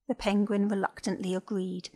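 ordinary delivery, nothing marked

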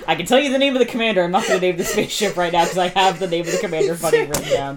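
fast words through real laughter; laughing. Real, not acted. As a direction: crack up while explaining. GM laughing mid-sentence.

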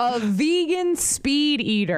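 slow, high disbelieving repeat; incredulous. Real, not acted. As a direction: draw it out in disbelief. Incredulous repeat of odd phrase.

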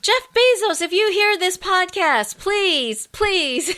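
high, loud, playful appeal; mock begging plea. Mock public plea to a billionaire.

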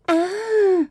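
very high breathy baby-voiced sigh. Shown as a demonstration. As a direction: squeak it out like a ditzy baby voice.

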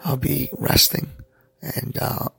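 rest the voice, barely voicing; weak after surgery. Real, not acted. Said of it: "I hope you can understand my voice".

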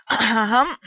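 loud pointed ahem; butler's throat clearing. A narrator's reading. Francois interrupts the dinner to announce, with a loud throat clearing. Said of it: "Francois said".

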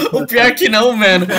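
loud laughing reply. Real, not acted. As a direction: laugh through the answer.

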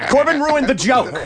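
high-pitched, amused accusation; mock outrage. Real, not acted. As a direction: cry foul over a spoiled joke. Mock accusation over spoiled joke.

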